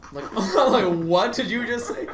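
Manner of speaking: high, baffled retort through laughter; laughing disbelief. Retold or imitated. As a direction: crack up while acting incredulous. Cracking up, retelling his baffled reply.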